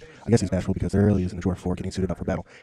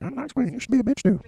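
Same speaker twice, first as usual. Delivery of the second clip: squeaky falsetto queen; high-pitched caricature of a haughty queen; mock ice queen. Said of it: "the Ice Queen again is like".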